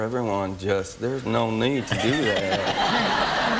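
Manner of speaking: very slow, stretched-out mock Southern drawl; slow Southern pace. Actor imitating unhurried locals in Louisiana, slow drawl. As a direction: drag every word out as if wilting in heat. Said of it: "I talk quite quickly in my natural thing, but".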